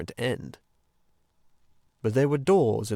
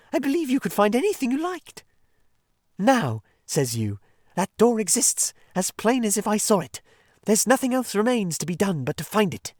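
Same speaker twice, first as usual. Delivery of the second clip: high, mocking voice of the Rat teasing his friend; sarcastic Rat; 9 semitones higher, 4 dB louder than usual. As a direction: pour on the false praise, higher. Rat mocks Mole's clever reasoning.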